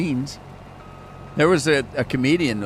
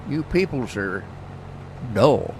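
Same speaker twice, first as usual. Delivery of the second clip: quoting a Red Dead line. Retold or imitated. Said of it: "that's from Red Dead".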